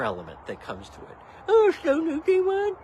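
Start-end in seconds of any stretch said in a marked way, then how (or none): 1.5-2.8 high, loud sneering sing-song; mocking high commenter voice. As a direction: mimic the snide commenter. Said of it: "So annoying".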